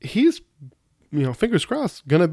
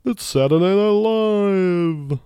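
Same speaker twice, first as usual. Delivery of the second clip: much higher, loud, very slow announcer boom; bad Don Pardo impression; 7 semitones higher, 5 dB louder, much slower than usual. Announcing the show in a hammed-up announcer voice. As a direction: stretch it out like a TV announcer. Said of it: "that was a bad Don Pardo impression".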